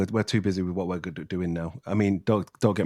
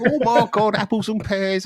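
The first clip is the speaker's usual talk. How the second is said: high-pitched, hammed-up Cockney impression; 13 semitones higher, 4 dB louder, slower than usual. Shown as a demonstration. Northern speaker shows the classic London accent, hammed-up high Cockney. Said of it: "Cockney voice and that".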